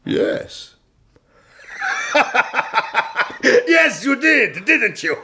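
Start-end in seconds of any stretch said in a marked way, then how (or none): 1.8-3.4 a quiet amused laugh under the breath; soft breathy chuckle. Acted. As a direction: chuckle softly, pleased with the answer. Sultan amused at prisoner's answer.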